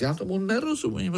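high, fast, puzzled outburst; impatient. A narrator's reading. Pája can't bear the silence any longer, impatient. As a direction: blurt it out high and quick. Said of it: "Pája už to nemohl vydržet".

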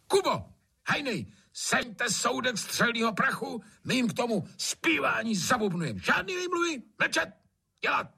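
high, agitated tyrant master; short-tempered shouted commands, pitched very high; barked orders. A narrator's reading. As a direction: command the servants, allowing no excuses. Master barks orders at servants.

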